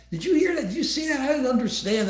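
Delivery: can you believe this, high and amused; incredulous. Real, not acted. Host to co-host about a commenter, incredulous high pitch.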